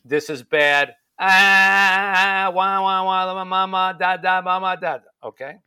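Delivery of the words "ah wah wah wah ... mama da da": loud mock baby wail; crying baby doll. One-year-old howling imitated.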